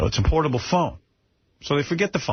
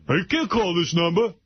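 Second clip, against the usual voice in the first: higher mock-rude brush-off; shitty attitude; 5 semitones higher than usual. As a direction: imitate a rude mechanic brushing her off. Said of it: "giving her a really shitty attitude".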